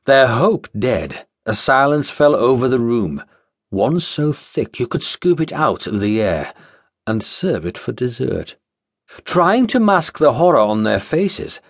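plain reading voice

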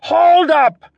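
loud, high hoarse shout; hoarse yell. A narrator's reading. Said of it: "a man yelled hoarsely".

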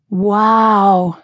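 long-drawn, starry-eyed swoon; dreamy sigh. A narrator's reading. Smitten helper about the handsome stranger, sighing dreamily. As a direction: breathe it out as if smitten. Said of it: "breathed in a ridiculous, dreamy sigh".

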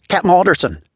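high, loud, urgent call out; excited call. Acted. Comms officer bursting onto the bridge, excited and breathless. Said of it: "quite excited and out of breath".

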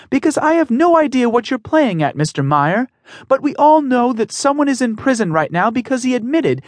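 a daughter standing up to a bully, high and strained; young woman pleading. Acted. Emmy confronts Mister Meyer, firm high pleading voice.

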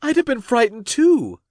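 very high, emphatic exclamation; high-voiced dramatic sympathy; crying out. A narrator's reading. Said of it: "Cassie cried".

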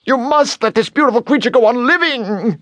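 very high, strained outcry; high-pitched impassioned demand; indignant yell. A narrator's reading. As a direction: yell it as a tiny outraged creature. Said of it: "the bone yelled".

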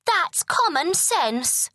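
high, slow queen voice; drawn-out prim queen; haughty queen. A narrator's reading. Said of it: "said the queen".

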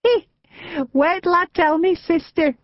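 put-on tickled old woman chuckling it out; old lady chuckling to Louise. A narrator's reading. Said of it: "she chuckled".

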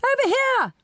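very high-pitched posh call. A narrator's reading. Posh man waving to the auctioneer, calling out. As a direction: call high to catch someone's attention. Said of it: "called the posh voice again".

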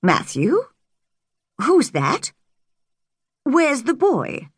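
high, startled questions rising to a shout; Marilla, stunned to see a girl, cries out; surprised cry. A narrator's reading. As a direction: gasp it out in shock at the door. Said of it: "she cried in surprise".

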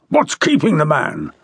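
impatient, fuming demand. A narrator's reading. General demanding where the chief inspector is, fuming. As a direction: demand an answer, exasperated. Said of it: "Raikes fumed".

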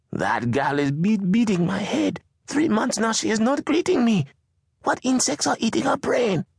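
very high, indignant mother; exasperated mother voice. Acted. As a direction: complain bitterly as the mother. Mama complains about daughter.

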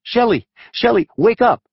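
high frantic scream of his name. A narrator's reading. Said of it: "she screamed".

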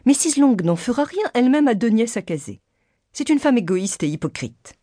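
high, indignant character voice easing down; indignant mother. A narrator's reading. Mother runs down missus Long to her daughter, indignant.

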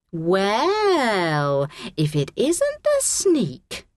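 high, drawn-out snide greeting; mocking schoolgirl drawl; taunting. A narrator's reading. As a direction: needle her with slow relish. Kira taunts Abby from behind.

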